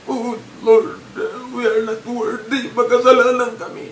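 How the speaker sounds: high, loud, hammed-up weeping prayer; mock crying prayer. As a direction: wail it like a showy fake cry in prayer. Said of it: "bigla kang umiyak".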